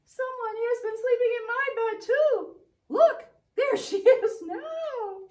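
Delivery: a little bear shrieking in alarm, pointing; Baby Bear crying out. Acted. Baby Bear cries out that Goldilocks is in his bed. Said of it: "Baby Bear cried out".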